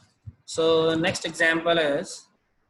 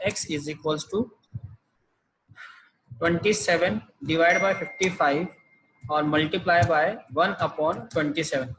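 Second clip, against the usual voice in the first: slow dictation; much slower than usual. Shown as a demonstration. Teacher dictates formula to copy.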